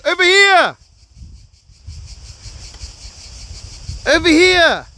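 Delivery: high loud calls across the water; calling out. Real, not acted. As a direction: shout to get their attention. Calling out to the seals.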